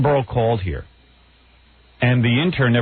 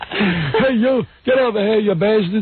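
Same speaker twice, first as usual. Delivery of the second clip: put-on high, angry shout of a cranky old man; higher, hammed-up geezer yelling get over here; mock old-man challenge; 8 semitones higher, slower than usual. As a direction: play a cranky geezer picking a fight.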